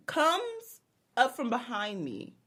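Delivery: ordinary talk, nothing marked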